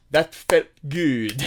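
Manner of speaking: put-on slow, pleased French-accented line; a hint of Clouseau's French accent. Quoting Clouseau after falling downstairs.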